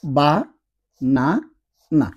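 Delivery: BA, NA, NA as separate syllables, pauses between. Shown as a demonstration. Teacher reads out the new word for learners, syllable by syllable. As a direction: sound out "বা, না, না" slowly. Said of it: "তাহলে বিষয়টা দেখেন".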